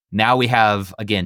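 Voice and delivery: ordinary talk, nothing marked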